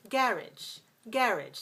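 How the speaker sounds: garage as GARR-idge, stress up front; British pronunciation. Shown as a demonstration. Teacher demonstrating British stress pattern, garage as GARR-idge. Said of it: "In British English".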